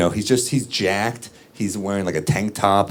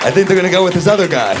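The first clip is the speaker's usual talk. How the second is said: rushed excuse; 10 semitones higher, 6 dB louder, faster than usual. Retold or imitated. Punchline to mom.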